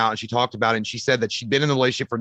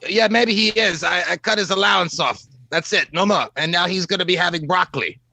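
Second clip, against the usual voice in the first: higher-pitched put-on doctor voice; stern guardian in a raised character voice; Doctor Loomis; 5 semitones higher than usual. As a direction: lay down the law in character, pitched up.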